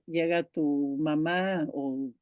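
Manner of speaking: ordinary talk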